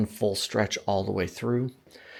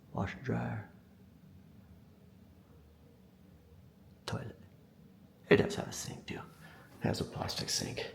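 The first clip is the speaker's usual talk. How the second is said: whispering; 16 dB quieter than usual. Pretend. Hiding the laundry-room toilet joke.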